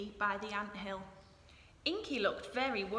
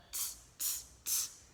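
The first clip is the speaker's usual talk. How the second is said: tennis ball ticks. Retold or imitated. Imitating tennis ball hits.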